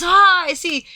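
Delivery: high, loud knowing merchant; a showy knowing aside. Acted. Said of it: "He goes".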